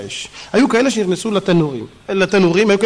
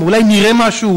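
ordinary talk

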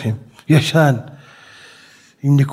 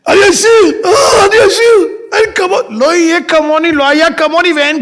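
ecstatic shout; 13 semitones higher than usual. Acted. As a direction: scream with sudden wealth. Man finds everything turns gold.

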